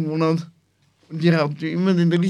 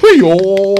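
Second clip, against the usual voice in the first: loud greeting; 11 dB louder than usual. Real, not acted.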